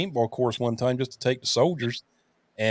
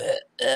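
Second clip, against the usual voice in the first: short choked gasps, acted out; imitated gasping. Retelling a paintball hit to the throat, imitated gasps. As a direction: mimic struggling for air. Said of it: "was gasping for air".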